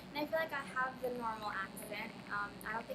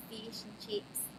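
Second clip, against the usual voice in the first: "fish and chips" with tight, long Australian ee-vowels; Aussie accent on fish and chips; 3 semitones lower, 3 dB quieter, slower than usual. Shown as a demonstration. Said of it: "in, in Aussie accent".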